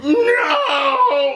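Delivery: high, drawn-out strained howl; hammed-up cry of horror; mock anguished scream. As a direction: wail it like an overblown movie hero. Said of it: "his face all, like, blew up".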